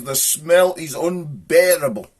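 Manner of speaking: slow, high and loud revulsion; hammed-up appalled complaint; comic disgust. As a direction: stretch it out, overcome by the stench. Acted recoil from bad smell.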